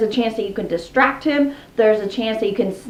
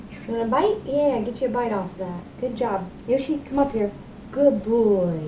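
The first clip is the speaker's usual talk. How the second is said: softer, coaxing words to a parrot; soft talk to the bird; 8 dB quieter than usual. Real, not acted. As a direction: coax the bird gently, much softer. Talking softly to her birds.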